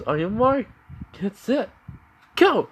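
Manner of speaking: high announcer countdown building to a shout; starting call. Acted. Girl announcer starts Bond's timed run, high rising shout.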